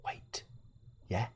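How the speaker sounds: breathy hushed wait; sudden whispered wait. Real, not acted. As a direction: stop and whisper it.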